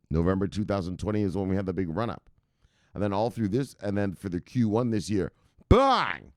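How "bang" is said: loud bang shout. Real, not acted. Hyping the price jump.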